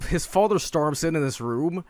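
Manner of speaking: ordinary delivery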